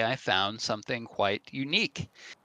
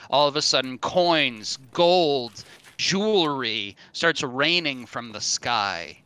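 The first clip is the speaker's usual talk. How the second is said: normal talking voice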